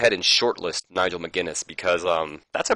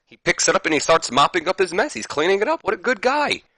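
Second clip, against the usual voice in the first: excited; 11 semitones higher than usual. Retold or imitated. Gushing narration of mop scene.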